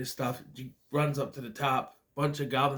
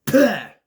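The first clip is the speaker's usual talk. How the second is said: mock disgust; 6 semitones higher, 11 dB louder than usual. Storekeeper's disgust.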